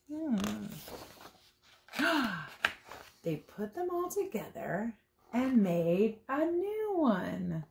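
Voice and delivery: normal talking voice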